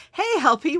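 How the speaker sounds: ordinary delivery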